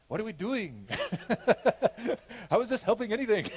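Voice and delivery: high, laughing mock French accent for Bruno's question; a clumsy French accent, giggling through it; bad French accent. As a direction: ham up a French accent while cracking up. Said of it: "That wasn't a very good French accent".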